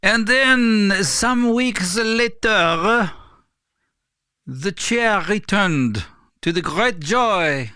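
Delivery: slow, high-pitched bad French accent; awful French accent. Vlogger narrating his chair's return in a deliberately bad French accent. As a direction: ham up a clumsy French accent, drawing out each word. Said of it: "That's an awful French accent".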